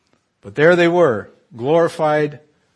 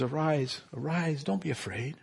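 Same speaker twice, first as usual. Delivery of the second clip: softer, tender reassurance; gentle Jesus; 3 semitones higher, 8 dB quieter than usual. Acted. Jesus comforting disciples fallen in fear, softly. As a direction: comfort them like someone lifting a frightened friend.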